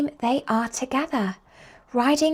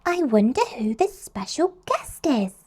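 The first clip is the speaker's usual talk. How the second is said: Peppa wondering; 4 semitones higher than usual. Acted. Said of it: "says Peppa".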